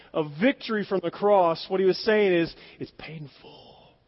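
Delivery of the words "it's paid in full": breath-only, far softer near-whisper; hushed. Acted. Preacher voicing Christ's words from the cross, whispered. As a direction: whisper it as a solemn reveal.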